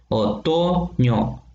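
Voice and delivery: "Otoño" drawn out with a long "to", then "ño" apart; stressed-syllable demo. Shown as a demonstration. Teacher showing the two O vowels, "Otoño" split slowly. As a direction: say "Otoño" very slowly, leaning on the middle o. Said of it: "la O y la O".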